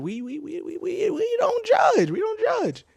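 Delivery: very high, stretched squeaky stammer; mock-defensive high-pitched aside; playful squeal. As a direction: climb into a silly falsetto and squeal it. Jokey stammered aside about buying again.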